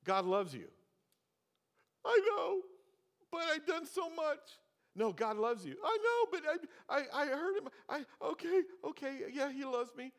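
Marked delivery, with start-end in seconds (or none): 2.0-4.4 much higher, louder pleading voice of an unsure believer; doubting believer. Pretend. Preacher plays a guilty believer answering God's love, high plea. 5.8-10.1 much higher, louder pleading voice of an unsure believer; doubting believer. Pretend. Preacher plays a guilty believer answering God's love, high plea.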